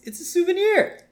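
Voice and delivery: high-pitched mock justification; defensive cartoon excuse. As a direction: insist on it in a squeaky-high protest. Homer defensively justifying theft.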